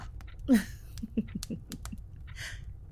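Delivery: soft breathy sinister chuckle; whispered laugh. Acted. Mother chuckling softly at her son's threat. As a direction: laugh under your breath.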